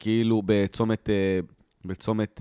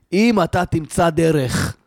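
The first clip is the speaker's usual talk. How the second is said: slow, raised-pitch belittling prediction; mock him with drawn-out, loud disdain; scornful imitated father; 5 semitones higher, 4 dB louder, slower than usual. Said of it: "הוא אמר את זה בזלזול".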